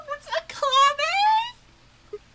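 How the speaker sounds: high, slow, breathy disbelief; stunned gasp. Real, not acted. Gamer shocked by the reveal, stunned disbelief.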